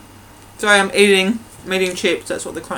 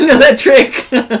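laughing; 6 semitones higher, 8 dB louder, much slower than usual. Real, not acted. Laughing, drawn-out word.

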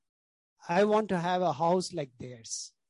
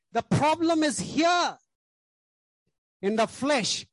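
higher and louder, hammering the point; preaching shout; 7 semitones higher, 3 dB louder than usual. Acted. Preacher shouting the root cause to the congregation, higher and louder.

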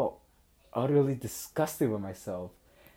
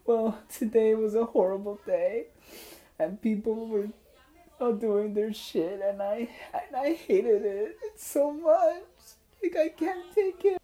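high whining mock cry, climbing higher; hammed-up sobbing complaint, pitched way up; imitated tearful girl; 11 semitones higher than usual. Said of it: "And she said".

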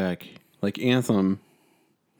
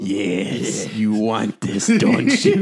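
sinister tempting Emperor, laughing at the end; 4 dB louder, much slower than usual. Retold or imitated. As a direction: tempt them slyly like a dark emperor. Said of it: "him being like".

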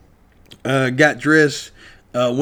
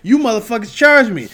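higher and louder, furious accusation; 8 semitones higher, 5 dB louder than usual. Real, not acted. Overcharged customer accusing the hotel, furious. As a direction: throw the blame right at them.

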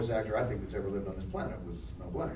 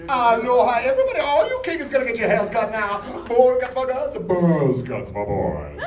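loud, high, deliberately bad Hawaiian accent; a crazy principal hamming up a Hawaiian accent; Principal Kudo Hawaiian accent; 11 semitones higher, 11 dB louder than usual. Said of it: "the worst Hawaiian accent that's ever been".

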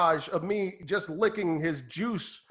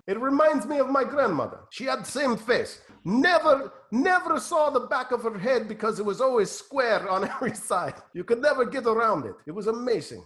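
put-on higher, fond Russian-accented voice; Russian handler reminiscing; 5 semitones higher than usual. Handler comparing the fighter's face to grandmother's, Russian accent.

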